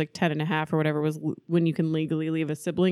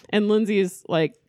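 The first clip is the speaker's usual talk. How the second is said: ordinary talk, nothing marked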